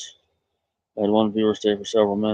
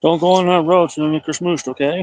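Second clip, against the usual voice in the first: higher, louder warning to the pet; calling a warning; 6 semitones higher, 4 dB louder than usual. Real, not acted. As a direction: call the warning after it. Warning pet about the road.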